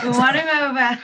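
said through a laugh. Real, not acted. Laughing about winning husband.

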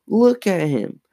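high-pitched, urgent pointing out; accusing. A narrator's reading. Lindsay points Mom to the dummy, accusing. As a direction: insist they look, pitched high. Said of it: "Lindsay accused".

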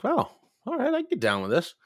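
high surprised well all right; a jump up in pitch, amazed. Retold or imitated. Narrator reacting to the dive bar, surprised.

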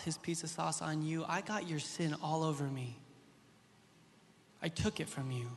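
quiet, tender divine reassurance; the Lord speaking tenderly. Retold or imitated. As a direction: speak gently, leaving long pauses. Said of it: "he says".

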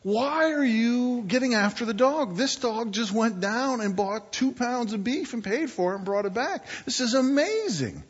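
high-pitched, incredulous protest; astonished onlooker. Acted. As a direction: jump in amazed on the dog's behalf. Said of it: "the guy that's been watching this says".

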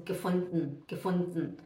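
Gefunden as "ge-fund-n", low and soft; E dropped. Shown as a demonstration. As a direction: drop low and end Gefunden on bare n. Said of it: "A gente fala gefunden".

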